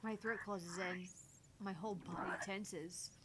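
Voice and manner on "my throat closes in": ordinary delivery, nothing marked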